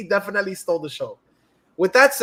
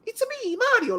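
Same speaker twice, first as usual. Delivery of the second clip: Mario impression. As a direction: introduce yourself like the game hero. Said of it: "he was channeling Mario".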